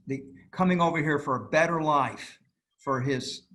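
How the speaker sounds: usual speaking voice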